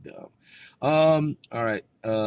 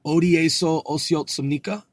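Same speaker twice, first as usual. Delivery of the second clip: "어디에서 오셨습니까?" higher, rising at the end; questioning intonation; 6 semitones higher, 5 dB louder, much faster than usual. Shown as a demonstration. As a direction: ask "어디에서 오셨습니까?" as a clear question, pitched up. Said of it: "I'm asking the question".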